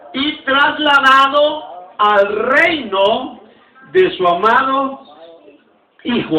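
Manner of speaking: slow, word-by-word recitation with pauses; slow unison reading. Shown as a demonstration. Pastor leading the church in reading the verse aloud, slowly. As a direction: lead the congregation phrase by phrase, drawn out. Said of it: "Dígalo conmigo".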